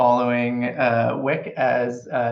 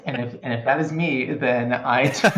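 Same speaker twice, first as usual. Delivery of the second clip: normal talking voice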